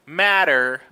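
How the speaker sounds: matter with the T tapped like a quick R; flapped T. Shown as a demonstration. Teacher illustrating T between vowels, flapped T in matter. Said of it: "sounds a little bit like an R".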